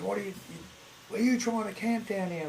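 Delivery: imitating a bloke calling up the hill; gruff bloke yelling.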